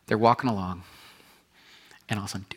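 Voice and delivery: ordinary delivery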